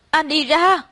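high acted shout to get out; high, upset order to leave; screaming. A narrator's reading. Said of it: "Tôi la hét vang cả phòng".

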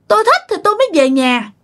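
high-pitched, wilful ghost retort; defiant spirit. Retold or imitated. Possessing spirit answers the exorcist, high and defiant.

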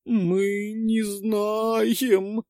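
put-on very slow, high, quavering girls; trembling-lipped girls. A narrator's reading. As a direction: quiver it out like scared girls. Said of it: "дрожащими губками хором сообщили девочки".

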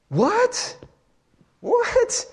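high, squeaky-pitched mock disbelief; incredulous. Pastor reacting to preachers' money promises, high incredulous question. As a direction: shoot the pitch right up in disbelief.

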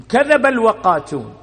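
كذب called out loud and falling; loud proclaiming. Acted. Preacher to congregation, loud proclamation. Preacher repeats the hadith louder.

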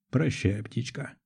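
soft, breathed-out goodbye; exhaled farewell. A narrator's reading. Narrator to the man falling away, softly exhaled. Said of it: "выдохнул я".

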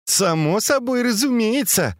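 high-pitched ironic agreement. A narrator's reading. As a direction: agree with mocking irony. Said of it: "с иронией произнесла Фэнзе".

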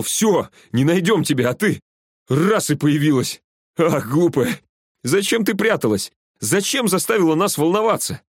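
excited, high-pitched scolding full of relief; breathless-sounding happy rush, pitched high; relieved outburst. A narrator's reading. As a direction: gush with joy, chiding fondly. Overjoyed Глеб finds missing friend.

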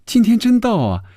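high-pitched giggly woman's voice; tickled, sing-out high delivery; laughing, amused. A narrator's reading. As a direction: open with a titter, then tease in a high voice. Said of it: "笑着说".

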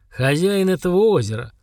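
lightly voiced lord of the lake; proclaiming monster. A narrator's reading. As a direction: declare your title weightily. Monster proclaims itself lake's owner.

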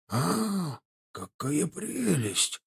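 slow, soft, awed whisper. A narrator's reading. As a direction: breathe it out in delight. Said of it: "прошептал он". (восхищённый шёпот)

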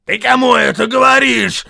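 very high, outraged troll shout. A narrator's reading. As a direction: shout back, challenging him. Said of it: "крикнул Вильям".

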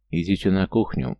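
ordinary delivery

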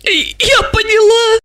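slow, very high whimper; tearful sniffle. A narrator's reading. Asya answers Gorokhov after sniffling, slow tearful whine. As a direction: whimper it through tears. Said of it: "Ася хлюпнула носом".

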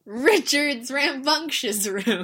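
a high, loud, very slow announcer voice; show announcer. Pretend. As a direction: announce the title grandly. Announcing the next segment.

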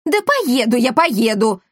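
loud high pained yell. A narrator's reading. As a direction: yell it out when pinched. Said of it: "завопил господин Монпансье".

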